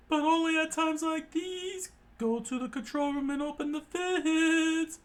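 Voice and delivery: high screechy voice barking orders; screeched orders. A narrator's reading. Boss orders a cook to the control room, screeching high. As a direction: squawk the command at a very high pitch.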